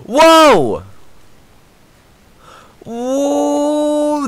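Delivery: very high, loud drawn-out whoa; astonished gamer whoa; amazed whoa. Real, not acted. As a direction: let the whoa soar up in shock. Amazed at player's moves.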